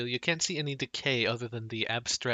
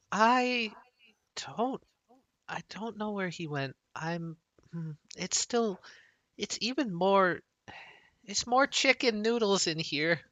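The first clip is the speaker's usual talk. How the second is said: Hazel's voice; 5 semitones higher than usual. Acted. Game master as Hazel sensing the floor, higher, halting. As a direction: grope for words, higher and hesitant.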